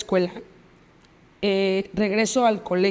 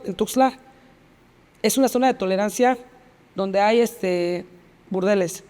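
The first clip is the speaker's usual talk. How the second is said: ordinary talk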